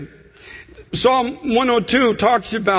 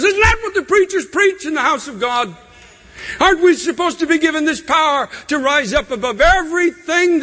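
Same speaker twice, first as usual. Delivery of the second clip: raised, pleading preacher's prayer, building; impassioned pleading prayer; 4 semitones higher than usual. Acted. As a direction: cry out to God in full preaching force.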